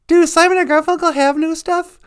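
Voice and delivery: very high cooing voice; high pet voice. Acted. A squeaky cooing voice praising the host's intro. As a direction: coo it like baby talk.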